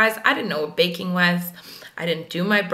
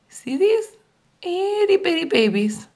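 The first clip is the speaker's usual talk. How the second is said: cutesy high voice; 9 semitones higher than usual. Real, not acted. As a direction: coo over them like babies. Cooing over short lashes.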